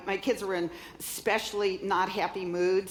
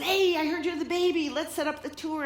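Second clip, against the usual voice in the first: lightly voiced tour promoter, higher and bright; playing the promoter, pitched higher; [name]'s eager voice; 8 semitones higher than usual. Promoter pitching the tour to her, higher eager voice. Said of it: "He says".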